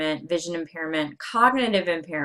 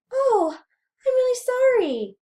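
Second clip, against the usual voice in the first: very high, louder squeaky pitch; 5 dB louder than usual. Shown as a demonstration. Lecturer demonstrates pitch to students, very high voice. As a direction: pitch the whole apology way up.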